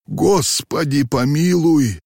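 high, slow moaned prayer; near moan. A narrator's reading. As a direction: draw it out like a frightened groan. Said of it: "почти простонал старик".

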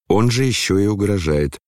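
plain reading voice

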